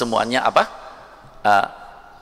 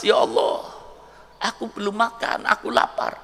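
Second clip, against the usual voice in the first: high, whispered sob of a starving beggar; crying; 8 semitones higher than usual. Acted. Kicked-out beggar crying to God, high and whispered. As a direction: weep it out in a high broken whisper. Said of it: "sambil nangis".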